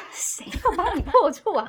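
loud, slow, laughing in disbelief; laughing retort. Retold or imitated. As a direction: blurt it out while cracking up, high and loud. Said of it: "就想说". (边笑边大声高声反问)

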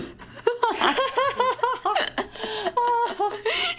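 long high burst of real laughter; real laughter at her own silly reply; loud laughter. Real, not acted. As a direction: crack up, rising and rising.

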